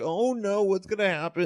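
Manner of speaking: high-pitched fretful woman, imitated; hammed-up anxious questioning; mock worry. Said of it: "she's all like".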